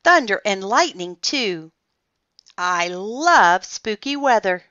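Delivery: loud, delighted storm-lover; excited exclamation. A narrator's reading. Olivia relishing spooky weather, excited exclamation. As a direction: punch it out with relish. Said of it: "exclaimed Olivia".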